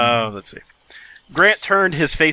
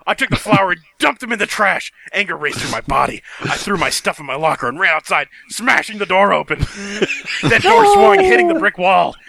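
hammed-up angry reading; 4 semitones higher than usual. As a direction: read it like an overwrought teen drama.

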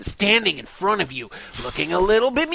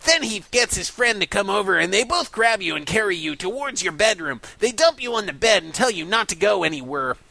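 normal talking voice

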